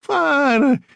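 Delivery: put-on scornful Italian accent. A narrator's reading. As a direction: throw out Fine in a thick Italian accent. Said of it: "She had a heavy Italian accent".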